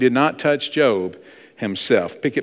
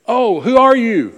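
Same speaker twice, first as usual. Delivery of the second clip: startled man asking; 11 semitones higher, 6 dB louder, slower than usual. Retold or imitated. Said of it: "He said".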